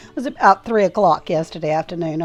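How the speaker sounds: ordinary delivery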